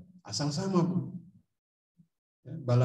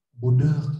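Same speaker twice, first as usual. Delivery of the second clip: mock-gentle retort; 7 dB louder than usual. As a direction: pretend to answer back tenderly. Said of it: "Saya balasnya lebih lembut". (balasan pura-pura lembut)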